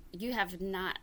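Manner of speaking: normal talking voice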